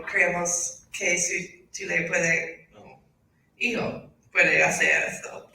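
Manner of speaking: learner reading Spanish, American accent. Real, not acted. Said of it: "Mi español no es muy bueno".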